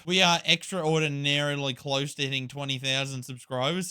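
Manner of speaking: ordinary talk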